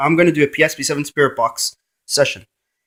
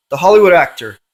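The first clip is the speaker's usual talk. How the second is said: ordinary delivery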